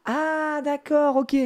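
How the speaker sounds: sudden high squeak of realization; mock realization. Dad mimicking his daughter, high mock surprise.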